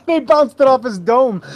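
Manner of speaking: high, words squeezed out through laughter; laughing. Real, not acted. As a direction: crack up mid-line. Laughing at the joke.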